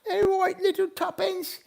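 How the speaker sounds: put-on high old British lady voice, tucking children in; sing-song granny British bedtime voice; old lady British accent. Old British lady saying goodnight to viewers, in a high old lady British accent. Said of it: "say goodnight in an old lady British accent".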